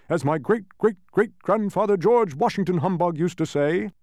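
high, loud patronizing bluster; blustering Humbug, advising. A narrator's reading. Humbug talks Milo out of spelling, blustering. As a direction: hold forth expansively to the boy.

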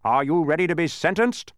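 high, loud legal reproof; officious policeman. A narrator's reading. Policeman lecturing the watchdog, officious and loud. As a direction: lay down the law loudly.

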